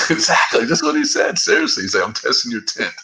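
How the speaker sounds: high-pitched talking through real laughter; laughing. Real, not acted. Man retelling trooper's tint excuse to friend, laughing. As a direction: laugh helplessly while insisting it is true. Cracks up retelling the absurd line.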